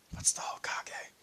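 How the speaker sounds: hushed, voiceless question; whispered aside. Acted. Newcomer asking who wants to meet them, whispered.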